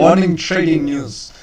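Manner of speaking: high, loud, bright broadcast opener; news anchor. Pretend. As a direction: open the morning news show. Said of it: "I'm gonna start doing the news for you guys".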